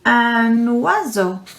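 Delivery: "Un oiseau" with a clear wa sound, slow; slow French word. Shown as a demonstration. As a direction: stretch "oiseau" so the wa is heard. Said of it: "Repeat after me".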